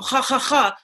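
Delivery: harsh guttural KH for each "ha"; imitated Arabic throat sound. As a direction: scrape "ha, ha, ha" in the throat. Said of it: "Even the Arabs".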